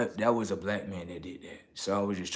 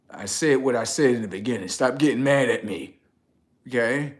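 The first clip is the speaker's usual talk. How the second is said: louder, pushing back at the audience; irritated raised voice; 2 semitones higher, 8 dB louder than usual. Real, not acted. To viewers, loud annoyed pushback. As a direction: stop them getting mad at you.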